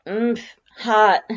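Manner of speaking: loud sputter at hot coffee. A narrator's reading. Burned by hot coffee, a loud sputter. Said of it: "you sputter".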